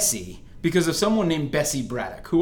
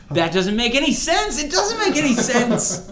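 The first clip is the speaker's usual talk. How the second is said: 1 laughing disbelief; 10 semitones higher, 4 dB louder than usual. Real, not acted.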